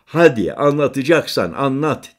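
loud, high urgent shout. A narrator's reading. Narrator shouts at Halit to start the story, loud and high. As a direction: shout at him to get on with it. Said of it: "diye bağırdım".

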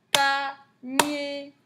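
slow teacher's split of Panier; syllable by syllable. Shown as a demonstration. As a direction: tap out PA, then NIER.

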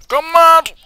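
high, loud squealing outburst. Acted. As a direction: shriek it like you've lost it. Playful squeal about going mad.

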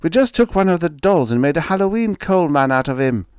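put-on high, loud child's explanation; small boy anxiously protesting; pleading. A narrator's reading. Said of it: "Robert pleaded".

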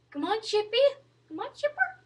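high, soft coaxing call; coaxing a pet. Real, not acted. Coaxing the bird.

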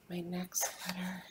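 soft secret whisper. Real, not acted. Teacher hushes for suspense.

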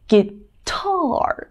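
"Guitar" split, with a long falling "tar"; stress on second syllable. Shown as a demonstration. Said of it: "后面是不是读得很重这个音".

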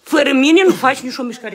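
normal talking voice

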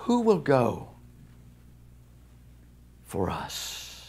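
a hushed, solemn heavenly voice. Retold or imitated. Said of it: "he heard a voice from heaven".

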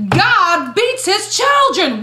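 each word slow, pitched high; slow emphatic. Real, not acted.

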